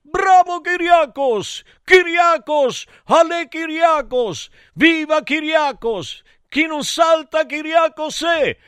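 very high chanting cheers; hammed-up high-voiced acclaim; cheering crowd. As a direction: chant like fans in a stadium, pitched high. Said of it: "gridavano tutti gli dei".

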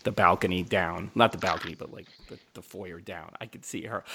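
ordinary delivery

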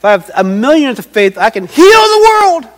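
hammed-up triumphant claim; grandiose boast. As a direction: belt it out like a mock miracle-worker.

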